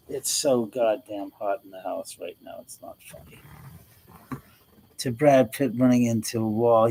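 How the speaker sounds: ordinary delivery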